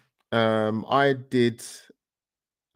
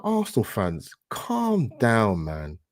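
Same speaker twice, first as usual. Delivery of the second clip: exasperated rant; 8 semitones higher than usual. Retold or imitated. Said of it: "And I'm like".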